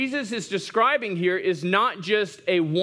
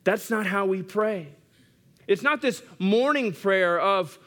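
ordinary talk, nothing marked

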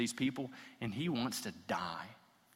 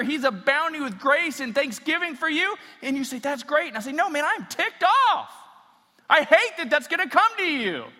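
much higher and louder, pushing hard; impassioned full-force preaching; 9 semitones higher, 10 dB louder than usual. Acted. Pastor preaching on Jonah's anger to his congregation, full force. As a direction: let the passion pour over the pews.

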